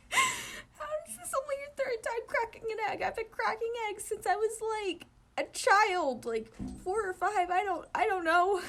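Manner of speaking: high, giggly and breaking up; can't keep it together; laughing disbelief. Real, not acted. Reader cracking up at the egg story, laughing.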